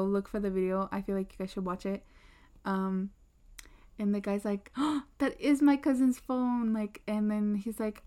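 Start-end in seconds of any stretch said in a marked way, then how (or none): 4.8-6.7 higher, slow, drawn-out retold amazement; mock astonishment. Kid sees the lock screen picture, mock astonishment. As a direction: imitate someone amazed by a discovery. Acting out the guy recognizing the phone.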